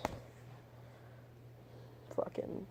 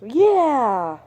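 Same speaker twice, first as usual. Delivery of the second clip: loud, high, overjoyed "Yeah!"; eager yeah; 7 semitones higher, 11 dB louder than usual. Shown as a demonstration. Accepting Chelsea's offer of help, shouted with glee. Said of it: "Oh, pessimist".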